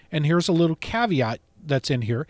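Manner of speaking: ordinary delivery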